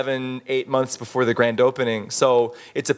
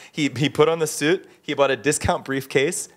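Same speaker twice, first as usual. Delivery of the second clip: ordinary delivery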